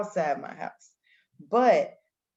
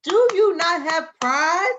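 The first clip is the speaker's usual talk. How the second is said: high, loud mock-indignant quote; Monique; 10 semitones higher, 7 dB louder than usual. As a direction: call the question out high and loud. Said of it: "She said".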